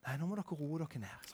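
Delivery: gentle mediator; calming peacemaker. Retold or imitated. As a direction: quietly settle the squabble. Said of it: "Så kommer den der".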